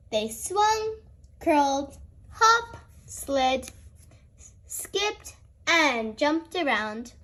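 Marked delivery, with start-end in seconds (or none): none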